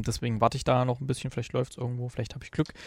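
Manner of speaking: ordinary talk, nothing marked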